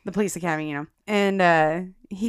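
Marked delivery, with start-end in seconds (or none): none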